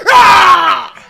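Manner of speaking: loud, high open-throated scream; mock She-Hulk roar. Streamer joking about She-Hulk vibes, roaring loudly. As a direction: let out a big playful monster roar.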